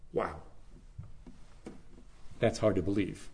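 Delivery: ordinary talk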